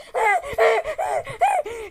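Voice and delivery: high burst of startled laughter; nervous laugh. Retold or imitated. Kid bolting from a noise in his room, startled laugh. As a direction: laugh out in a panic.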